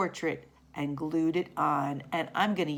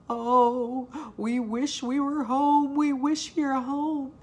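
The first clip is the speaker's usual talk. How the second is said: wishful pleading; 6 semitones higher, 4 dB louder, slower than usual. Acted. Dogs wish to be home.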